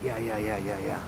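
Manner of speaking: soft, repeated yeahs of retold compliance; hushed agreement. Patient agreeing to keep his secret, hushed agreement. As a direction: back down softly, imitating the patient.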